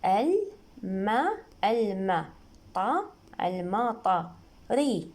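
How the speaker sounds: slow syllables building up to المطري; spelling out. Shown as a demonstration. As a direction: sound out "al", "ma", "ta", "ri" piece by piece. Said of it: "إذا نقراها بوحدها نقرأ ال". (تهجئة مقطعية بطيئة)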